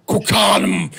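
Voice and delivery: a hammed-up Austrian Arnold impression. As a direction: bark it like Arnold Schwarzenegger's Austrian accent. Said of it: "best Arnold voice ever".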